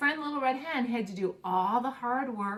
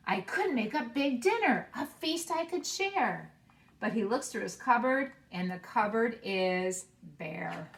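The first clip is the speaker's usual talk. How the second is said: put-on expressive read-aloud for young children; story narration; slower than usual. Teacher reading a picture book to her class, expressive read-aloud. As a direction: read the picture book warmly and slowly, bringing each animal to life.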